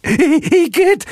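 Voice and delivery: very high, loud squeal of disgust; piercing cartoon yuck; disgusted Hel. A narrator's reading. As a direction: squeal in revulsion. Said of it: "machte Hel".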